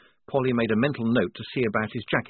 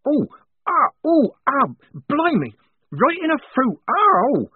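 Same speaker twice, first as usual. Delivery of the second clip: high, loud mock cries of pain; theatrical pained yelps; hammed-up lament; 8 dB louder than usual. A narrator's reading. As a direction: fake the agony to cover the truth. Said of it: "she lamented".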